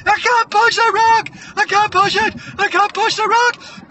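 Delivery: very high, loud squeal of struggle; hammed-up desperate yelling; desperate shouted protest. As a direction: wail it over and over under strain. Moana protesting under strain.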